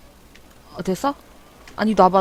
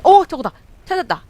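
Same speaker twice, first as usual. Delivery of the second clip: excited; 8 semitones higher, 9 dB louder than usual. Real, not acted. Spotting the target ship.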